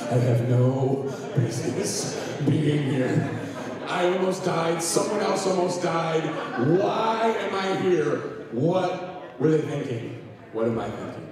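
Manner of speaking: mock the recruit's panic while laughing; laughing retelling. Preacher retells the recruit's line to camera, laughing. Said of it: "looking at the camera".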